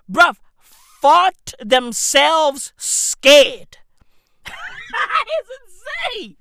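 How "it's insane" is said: high, soft squeal through laughter; laughing. Real, not acted. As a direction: squeeze the words out while laughing. Cracking up at his own story.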